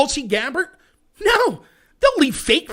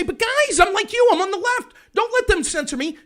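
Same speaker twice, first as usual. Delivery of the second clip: mock whiny plea, pitched up; a pleading leftist begging not to be censored, whiny and high; 8 semitones higher than usual. Lefty pleads with the crowd, mock whiny high plea. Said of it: "And you'll say".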